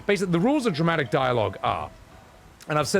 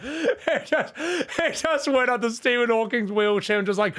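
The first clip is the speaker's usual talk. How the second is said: laughing; 11 semitones higher than usual. Real, not acted. Cracking up at funny photo.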